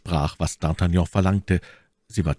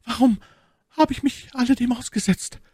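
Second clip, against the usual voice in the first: put-on much higher young maid's voice, sighing. A narrator's reading. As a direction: voice the hurt young maid Cathy, high. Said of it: "seufzte Cathy". (hohe, seufzende Stimme der jungen Zofe)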